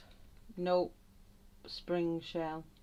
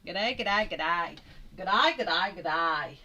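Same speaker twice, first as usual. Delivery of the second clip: Aussie accent; 9 dB louder, slower than usual. Shown as a demonstration. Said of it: "makes me sound even more Aussie".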